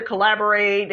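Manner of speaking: normal talking voice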